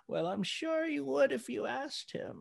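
put-on high, slow, frail old-lady voice; elderly voice imitation. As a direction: copy an elderly woman's wavering tone. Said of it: "Torres was sort of speaking like".

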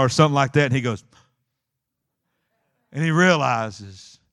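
normal talking voice

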